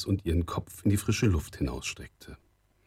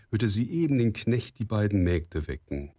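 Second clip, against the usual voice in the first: plain reading voice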